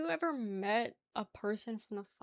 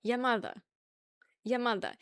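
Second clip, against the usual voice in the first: New York "ya mutha", R dropped; New York accent. Shown as a demonstration. Streamer correcting others' slang, New York accent. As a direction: end "mother" on an A, New York style. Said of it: "have mother with an A at the end".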